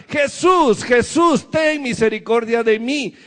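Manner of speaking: high pleading shout on the first Jesús, easing after; crying out. Acted. Blind Bartimeo begging Jesus from the roadside, shouting. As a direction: call out to Jesus over a crowd. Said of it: "que también le gritaba".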